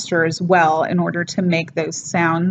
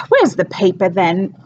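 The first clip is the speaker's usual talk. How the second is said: sighing Cockney aside; sigh, then grumble in stage Cockney; Mrs Clackett, thick Cockney. Acted. Housekeeper hunting for the paper, thick Cockney aside. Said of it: "very, very thick, overdone".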